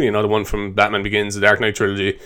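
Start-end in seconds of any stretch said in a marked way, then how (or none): none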